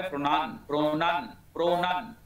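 প্রনান repeated as pro-nun, short second vowel; corrected pronunciation. Shown as a demonstration. Teacher drills the fix for students, saying প্রনান as pro-nun. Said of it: "এটা হবে".

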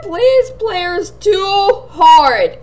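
slow whiny please; slow drawn plea. Real, not acted. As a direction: stretch every word out begging.

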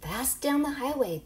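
plain reading voice

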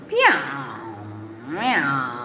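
long, drawn-out meowing; cat meows. Acted. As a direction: meow lazily like a waking cat. Imitating the waking cat.

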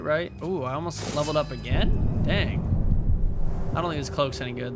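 ordinary talk, nothing marked

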